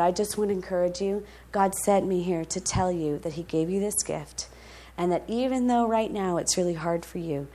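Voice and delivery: put-on soft, hushed and tender, speaking to a crying boy; gentle reassurance. To the weeping thirteen-year-old J C, hushed and tender. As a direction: lean in and comfort him quietly.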